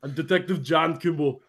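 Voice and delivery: put-on understated Austrian accent; soft mock-Austrian introduction; Arnold.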